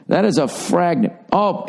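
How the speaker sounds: ordinary talk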